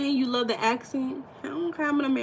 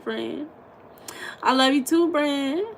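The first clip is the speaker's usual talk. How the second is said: ordinary talk, nothing marked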